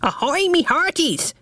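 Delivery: high squawky parrot. A narrator's reading. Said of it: "said Rumbucket, the Bumble's parrot".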